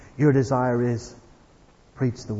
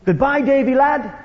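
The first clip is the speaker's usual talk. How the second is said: high-pitched mock shout, not full volume; retold calling out; 7 semitones higher than usual. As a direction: imitate an old tramp calling out a farewell. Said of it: "he shouted out".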